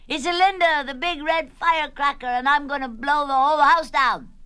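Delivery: high, loud playful threat; boisterous announcement. Acted. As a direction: belt it out like a child playing explosive. Rosie threatens as a firecracker.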